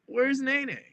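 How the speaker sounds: high-pitched mock-mom question; mimicked mom. Retelling her mom asking after her daughter, high mimicked voice. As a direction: put on a mom's high voice.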